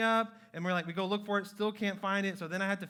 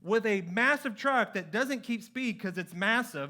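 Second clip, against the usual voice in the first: normal talking voice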